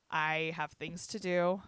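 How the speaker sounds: each word spaced out, slow; slow and firm. Retold or imitated. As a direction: lay it down slowly, not negotiable. Telling staff she's leaving.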